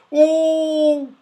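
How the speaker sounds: high startled oh; frightened cry. A narrator's reading. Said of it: "cried Moonface in a fright".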